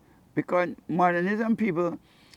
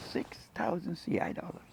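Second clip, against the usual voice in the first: hushed, amazed disbelief; a whispered sum, still in shock; awed whisper; 3 semitones higher, 10 dB quieter than usual. Real, not acted. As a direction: whisper the amount in awe.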